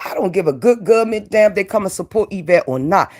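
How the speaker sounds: ordinary delivery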